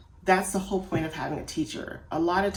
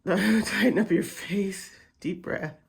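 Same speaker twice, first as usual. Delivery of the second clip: laughing coaxing; 2 semitones higher, slower than usual. Real, not acted.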